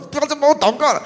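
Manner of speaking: high, strained mock plea; 高亢地半开玩笑哀求; pleading. Begging prayer helper to stop.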